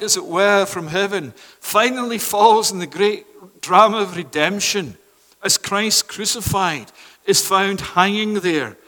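ordinary talk

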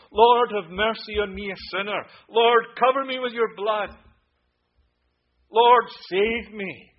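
loud, high pleading cry, slowing at the end; crying out in prayer. Acted. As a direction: plead with God at full voice. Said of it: "to cry out to Christ".

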